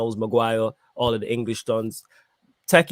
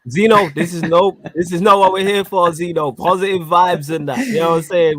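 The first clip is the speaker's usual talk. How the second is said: loud mock telling-off; playful scolding; 5 semitones higher, 5 dB louder than usual. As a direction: call him out, half joking. Playfully scolds co-host for negativity.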